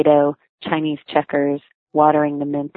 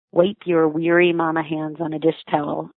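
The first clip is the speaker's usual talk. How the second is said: ordinary delivery, nothing marked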